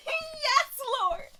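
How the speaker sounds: high, loud joyful exclaim; high loud praise of joy; elated cry. Real, not acted. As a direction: cry out in delight. Reaction to edges.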